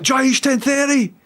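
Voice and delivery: high excited fan's yell; mock fan bellowing a wrong name; imitated excited shout. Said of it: "he shouted it".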